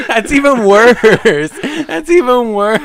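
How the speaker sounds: high, loud, laughing protest. Real, not acted. Co-host cracking up at friend stuck in chair, laughing. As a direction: squeal it through real laughter.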